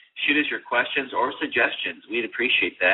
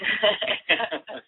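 high villain cackle; evil laugh; 11 semitones higher than usual. Acted. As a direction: cackle like a mad scientist. After "the world will be mine.

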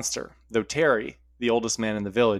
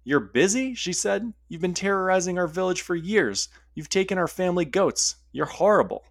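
infuriated; 7 semitones higher than usual. Acted. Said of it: "this infuriated Sarah".

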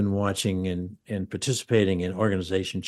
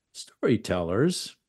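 ordinary talk

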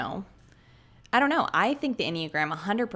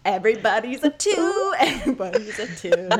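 higher, louder, laughing proclamation; laughing exclamation; 5 semitones higher, 4 dB louder than usual. Retold or imitated. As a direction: declare it gleefully through laughter.

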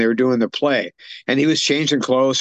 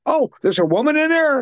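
quoted landlady; 13 semitones higher than usual. Retold or imitated. Landlady voiced in retelling.

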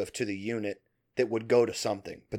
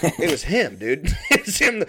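higher, laughing punchline delivery; amused reveal; 7 semitones higher, 4 dB louder than usual. Real, not acted. Storyteller revealing the foreman was the culprit, laughing.

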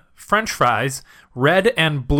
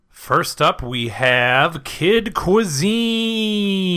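very slow announcer reveal; 4 semitones higher, much slower than usual. Pretend. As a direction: unveil it like a show host.

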